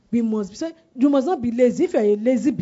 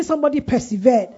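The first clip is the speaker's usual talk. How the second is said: loud, raised call for a response; louder prompt; 4 semitones higher, 6 dB louder than usual. Real, not acted. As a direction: make the congregation shout it back. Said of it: "I can't hear you".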